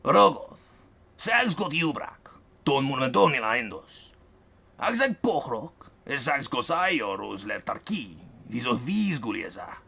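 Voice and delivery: slow, strange ogre tongue; Verks in his own tongue. A narrator's reading. Verks addressing the ogress in her language. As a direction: growl out the foreign words slowly. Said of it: "He addressed her".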